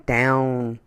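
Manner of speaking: ordinary delivery, nothing marked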